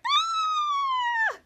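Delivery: high, sudden squeal of fright; a frightened girl shrieks in surprise; scared scream. Retold or imitated. Girl student scared by Henry, shrieking in fright. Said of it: "who was so scared".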